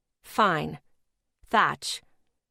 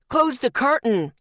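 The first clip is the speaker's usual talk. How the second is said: louder barked order; shouted order; 5 semitones higher, 5 dB louder than usual. Retold or imitated. Startled man in booth shouting at her, loud. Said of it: "the man shouted".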